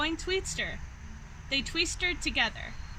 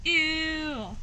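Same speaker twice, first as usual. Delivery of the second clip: high, louder grossed-out ew; disgusted ew; 4 semitones higher, 4 dB louder than usual. Acted. Vulture reads the mean tweet, disgusted. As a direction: recoil from it.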